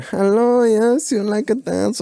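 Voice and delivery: high put-on stripper voice, slow and coaxing; stripper come-on. Strippers coming over to the lads, high coaxing voice. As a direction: purr the offer like a club dancer.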